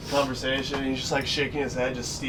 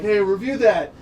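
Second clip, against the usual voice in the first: higher, louder call to the umpire; 6 semitones higher, 6 dB louder than usual. Retold or imitated. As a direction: shout for the review. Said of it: "Tito's like".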